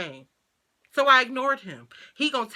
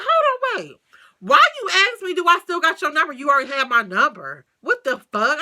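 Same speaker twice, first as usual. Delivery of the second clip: much higher and louder, exasperated; outraged; 7 semitones higher, 7 dB louder than usual. Retold or imitated. Confronting the ex over asking for her number: high, loud outrage. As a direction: call him out, furious.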